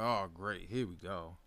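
low, quiet resigned aside; resigned sarcasm. Retold or imitated. Fan reacting to a home run given up, resigned. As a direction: grumble it softly under the voice.

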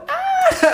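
very high loud mock shriek; squeal. Imitating the bride's shriek, very high and loud.

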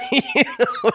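squeaky burst of laughter; laughing high. Real, not acted.

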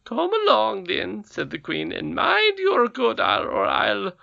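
high, loud squeaky warning; menacing goblin queen. A narrator's reading. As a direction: threaten as she leads him off.

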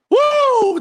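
a sudden high, loud whoop; hype whoop. Real, not acted. Streamer hyping the next podcast host, loud whoop. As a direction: cheer him onto the mic.

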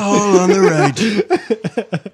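high, slow spooky voice; horror movie voice. Acted. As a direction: play it like a spooky horror line. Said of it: "scariest lines ever heard".

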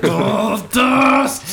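high, drawn-out mock yell; hammed-up shout. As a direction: belt it out like a silly catchphrase. Hammy shout of wrestler name.

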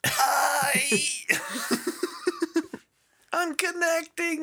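squeaky high-pitched wizard voice; high telepathic hi. Acted. Wizard connects telepathically in a squeaky high voice. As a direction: call out high and thin across the mind link.

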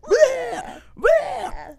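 high, far puking noise, bleh; mock retching. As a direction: fake gag sound. Guy puking in corner.

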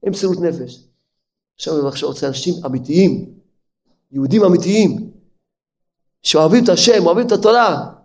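ordinary talk, nothing marked

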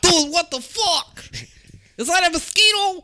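squeaky, high, cartoonish imitation of a friend; mocking high squeaky voice. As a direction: squeak it out like a mock version of your buddy. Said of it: "when they make my voice".

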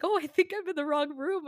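high, loud embarrassed realization; sheepish doctor. Retold or imitated. Doctor realizes his mistake to the mother, sheepish. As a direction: laugh it off as the flustered doctor. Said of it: "he kinda laughed it off".

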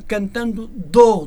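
"do" held long and loud as its own word; exaggerated "do". Shown as a demonstration. Singer explaining the verb dar to the host, exaggerated "do". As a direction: stretch "do" right out, very slow. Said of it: "Estou a exagerar".

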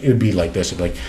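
usual speaking voice

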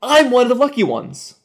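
very high, loud protest; distraught outcry. A narrator's reading. Survivor of the onslaught to the officer, distraught outcry. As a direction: cry it out in desperation. Wounded survivor tells of disaster.

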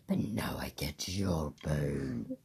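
low breathy whisper, half-joking. Pretend. Teasing her friend over a chess capture, whispered. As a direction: hush it like a secret gloat.

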